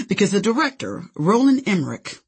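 plain reading voice

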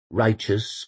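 ordinary delivery

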